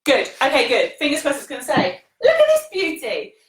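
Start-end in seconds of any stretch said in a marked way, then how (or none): 2.2-3.3 higher-pitched delighted admiration; admiring exclamation. Real, not acted. Yoga teacher showing her class a plant, delighted.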